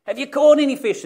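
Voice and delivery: high, loud call; yelled out. Acted. Preacher re-enacting the call from the beach, yelling. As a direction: holler it to rouse everyone. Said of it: "you're all awake 'cause I yelled".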